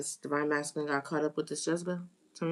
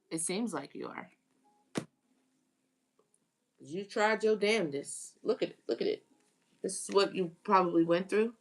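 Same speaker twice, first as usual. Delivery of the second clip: ordinary talk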